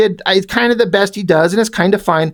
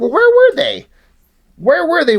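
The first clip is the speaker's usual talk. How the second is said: baffled retold reaction; 8 semitones higher than usual. Comic reader retelling his confusion at the setting, baffled.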